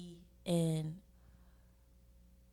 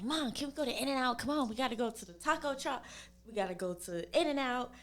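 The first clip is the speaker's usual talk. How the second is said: put-on lightly higher pleading child to mom; begging kid; 6 semitones higher, 3 dB louder than usual. Asking mom for In-N-Out as a child, light pleading. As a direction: whine a little like a kid begging. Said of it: "I'm like".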